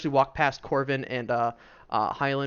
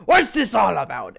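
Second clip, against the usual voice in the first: loud, higher grunt of a question; grunting dwarf; 6 semitones higher, 9 dB louder than usual. Acted. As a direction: call it out as a gruff dwarf craftsman. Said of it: "a grunt, kind of".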